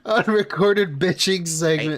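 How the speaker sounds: slow, drawn-out mimicry; mocking echo. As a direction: parrot the phrase slowly in mockery. Mocking repeat of streamer's phrase.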